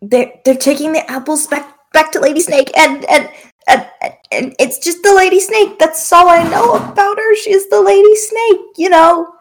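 high, squeaky, panicked blurting; panicked captive. Acted. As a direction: blurt it out in terror. DM voices scared captive.

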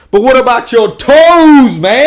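high, slow and loud disbelief; loud slow incredulous. Retold or imitated. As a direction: stretch it out in shock. Punchline retold, voice jumps. (incrédulo, alto y lento)

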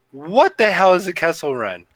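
mock baffled fan. A Star Wars fan wondering about the Kessel Run, mock bafflement. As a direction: ask it like a puzzled newcomer, half-joking.

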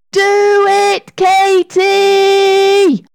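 very high, drawn-out call; urgent shout. A narrator's reading. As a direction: yell to make a friend act. Sarah desperately calls to Katy.

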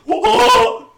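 very high, loud whoa; astonished whoa. Retold or imitated. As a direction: squeal in amazement. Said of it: "He was like".

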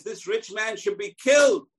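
high, loud verdict shouted out; stern royal verdict. Retold or imitated. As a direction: condemn him with full force. David pronounces harsh sentence.

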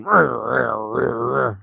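rolling imitated zombie growl. Retelling the film, imitating the zombie's growl. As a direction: growl like a mouth-sewn zombie. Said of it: "he spends most of the movie going".

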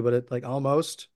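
normal talking voice

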